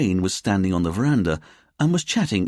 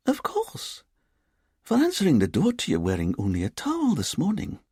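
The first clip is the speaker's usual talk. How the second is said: put-on lilting Edinburgh accent; 10 semitones higher than usual. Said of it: "in her lilting Edinburgh accent".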